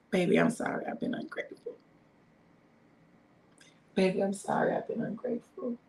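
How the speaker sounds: soft breathy whisper to her husband, almost no voice; whispered apology. Pretend. Wife whispering sorry to her husband, soft whisper. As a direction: hush it like a private sorry.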